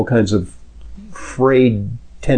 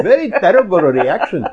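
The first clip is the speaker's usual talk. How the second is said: put-on strong Indian-Pakistani accent, pitched higher; 8 semitones higher than usual. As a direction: imitate the cab driver in a thick Indian-Pakistani accent. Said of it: "the strong, whatever, Indian, Pakistani accent".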